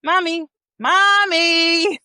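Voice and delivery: put-on slow, drawn-out high little-girl calls; imitating a child calling mommy. Mom retelling her daughter calling her, slow and high. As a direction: stretch each mommy like a teasing toddler. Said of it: "she said".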